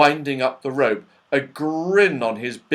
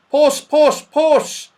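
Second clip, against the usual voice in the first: high, loud cat call; Saucepan Man calling; 9 dB louder, much slower than usual. A narrator's reading. Saucepan Man peers about for a cat, calling high.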